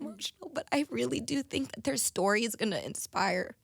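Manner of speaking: apologizing through real tears; tearful. Real, not acted. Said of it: "I don't mean to get so emotional".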